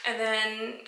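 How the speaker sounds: ordinary delivery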